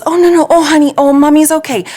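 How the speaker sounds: put-on high, loud, bubbly comforting of a crying baby; excited reassuring mom. Mom soothing her crying toddler during the football game, high and loud. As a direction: gush reassurance over the little one, up high. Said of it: "and she goes".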